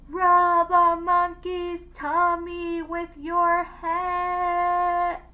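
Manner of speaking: high, loud, long-held chanting yell; distant echoing call. A narrator's reading. Fleeing woman calls back across the water, drawn-out yell. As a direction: holler it on one sustained high note.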